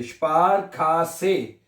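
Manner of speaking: Spar खासे slowly, "shpar-kaa-seh" in separate beats; spar खासे with a long, open खा; slow sounding-out. Shown as a demonstration. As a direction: stretch each syllable of spar खासे. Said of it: "के को खा पढ़ना है".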